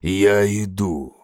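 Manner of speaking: slow, eerie inhuman call; alien creature voice. Acted. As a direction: summon your kin like a hungry beast. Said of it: "отправило оно немое послание".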